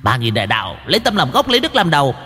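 high squeaky sermonizing imp; preachy imp voice. Acted. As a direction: lecture like a smug little demon. Preachy demon voice.

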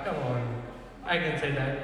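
ordinary delivery

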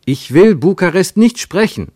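loud, high, insistent refusal; exasperated outcry; shouted protest. A narrator's reading. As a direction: shout it, hammering out each word. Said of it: "rief Ernst".

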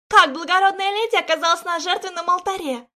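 put-on high, loud cawing old woman; croaking crone. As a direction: caw it out like a crow. Guardian questions.

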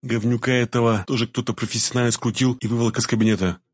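usual speaking voice

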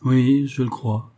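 slow, thoughtful reply; pensive. A narrator's reading. Gabriel answering Evelyne about her uncle's smile, slow and pensive. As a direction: answer slowly, mulling it over. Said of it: "répondit Gabriel d'un air pensif".

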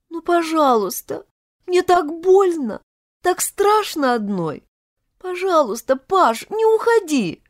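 high-pitched, pitiful begging; pleading, frightened heroine. Acted. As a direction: plead helplessly, voice climbing at the end. (испуганная девушка, высокая жалобная мольба)

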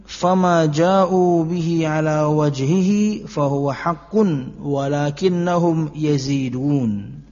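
slow, measured Arabic recitation with drawn-out vowels; deliberate scholarly recital of sacred Arabic; Arabic hadith recitation. Acted. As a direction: recite the hadith slowly, stretching the long syllables.

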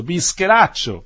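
loud, slow, drawn-out fond insult; amused, affectionate. A narrator's reading. Cigar maker teasing the young man she just met, amused and affectionate. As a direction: tease him fondly, pitched high. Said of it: "con tono divertito e a suo modo affettuoso".

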